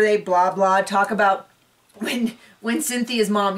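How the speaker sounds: ordinary talk, nothing marked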